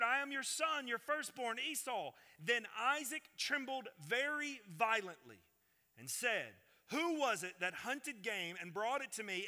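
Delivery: high, intense Bible narration; dramatic scripture reading. A narrator's reading. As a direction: read the story with rising urgency.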